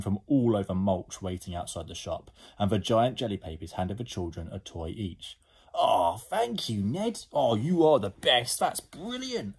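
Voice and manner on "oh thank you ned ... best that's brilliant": high, swooping thanks; delighted gushing. Acted. Children thank Ned for toys, delighted. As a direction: gush with delight, stretching the words.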